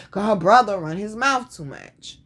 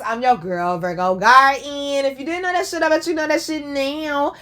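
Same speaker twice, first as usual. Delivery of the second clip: sassy sign-off; 6 semitones higher, 3 dB louder than usual. Real, not acted. Sassy self-introduction sign-off.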